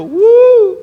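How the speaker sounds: a sudden, high, loud startled "uh". Retold or imitated. Preacher asked to give the message, a startled yelp. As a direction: yelp of surprise at the request.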